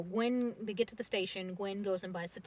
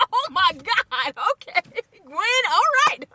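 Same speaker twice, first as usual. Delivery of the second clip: high, slow and much louder amazement; stunned awe; 13 semitones higher, 18 dB louder, slower than usual. Retold or imitated. The brothers reacting to Gwen, high stunned awe. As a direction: gasp it out in disbelief. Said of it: "they're just like".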